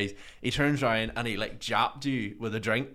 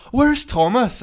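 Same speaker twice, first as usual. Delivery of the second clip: much higher, louder call; panicked asking; urgent question; 13 semitones higher, 9 dB louder, slower than usual. Retold or imitated.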